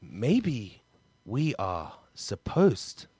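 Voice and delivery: hammed-up melodrama, word by word; word relay. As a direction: act the soap romance one word each. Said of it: "It's a one-word story".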